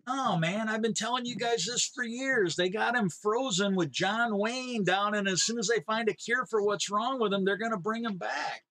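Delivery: a conspiracy nut who's sure he's been right for years; eager conspiracy nut. Retold or imitated.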